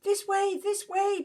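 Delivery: dog leading the way; barking. Acted. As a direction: bark the words urgently. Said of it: "barked Bear".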